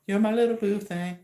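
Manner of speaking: teasing sweetheart voice. Pretend. Teasing the viewer about his boo, sugary mock voice. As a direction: coo it like a lovey partner.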